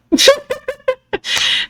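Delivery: high, breaking laugh with a sob in it; rueful laugh. Real, not acted. Recalling the stepmother's words, a high laughing cry. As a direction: laugh it off through near-tears.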